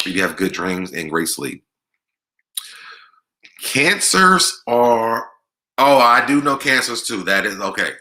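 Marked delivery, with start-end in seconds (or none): 3.6-7.2 excited. Real, not acted. Spotting a comment about her sign.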